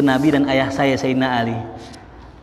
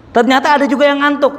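high, loud burst of surprise; excited exclamation; 11 semitones higher, 5 dB louder than usual. Acted. Preacher telling congregation someone dozed, loud and excited. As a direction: exclaim it, wide-eyed.

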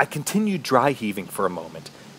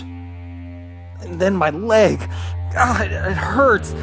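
higher, strained cry of pain; 7 semitones higher, much slower than usual. Acted. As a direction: let the pain break into the words. Narrator's leg pain strikes.

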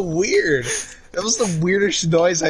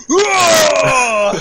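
loud startled whoa, higher; waking-up yell; 8 semitones higher, 5 dB louder than usual. Retold or imitated. Jokes about how he jumps out of bed, loud whoa. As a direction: burst out of bed yelling. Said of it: "I just jump the fuck out of bed and go".

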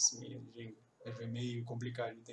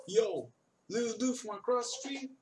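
a high, casual Brooklyn street greeting; imitating Brooklyn slang; 8 semitones higher, 3 dB louder than usual. Teacher imitates the character's Brooklyn greeting, high and casual. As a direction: call out like a kid on the block. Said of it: "o jeito que ele fala".

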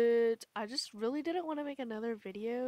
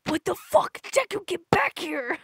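much louder, higher, slower shout; roaring chase yell; angry yell; 7 semitones higher, 10 dB louder than usual. Acted. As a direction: yell after him furiously. Yelling after Deku.

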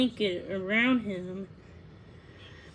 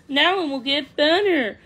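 high, loud, upbeat bird voice; cheerful relief; 5 semitones higher, 8 dB louder than usual. A narrator's reading. The swallow after the thorn is out, cheerfully relieved. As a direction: announce it brightly and loudly.

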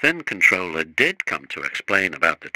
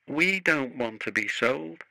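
softer, sorrowful plea, a little higher; miserable; 5 semitones higher, 5 dB quieter than usual. A narrator's reading. Little engine tells his driver he fears being sold, softly miserable. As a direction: sound sorry for yourself, softly. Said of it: "said Peter Sam miserably".

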